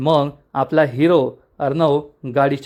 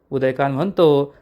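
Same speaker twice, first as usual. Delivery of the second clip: ordinary delivery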